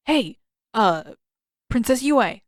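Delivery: calling out. Acted. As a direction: raise the voice to stop her walking away.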